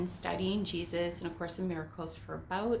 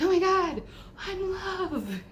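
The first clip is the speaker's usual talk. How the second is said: much higher, loud, overjoyed; ecstatic cry; 13 semitones higher, 7 dB louder, slower than usual. Retold or imitated. To herself in the store, a loud ecstatic cry. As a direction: burst out in rapture.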